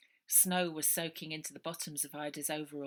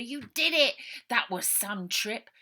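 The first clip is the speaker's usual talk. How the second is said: excited; 6 semitones higher, 8 dB louder than usual. A narrator's reading. Baby thrilled after the crash.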